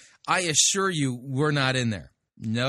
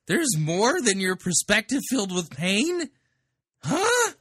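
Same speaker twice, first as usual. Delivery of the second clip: mocking incredulity; 7 semitones higher, 3 dB louder than usual. Real, not acted. Mocking the preacher's line back.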